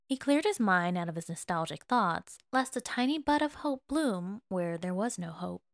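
ordinary delivery

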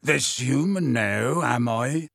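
slow, testy retort; a touch of testiness. A narrator's reading. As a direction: throw the label back, mildly irritated. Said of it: "said Hagrid testily".

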